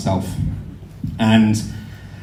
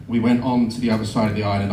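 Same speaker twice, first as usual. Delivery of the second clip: normal talking voice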